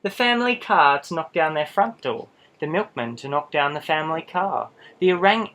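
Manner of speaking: ordinary delivery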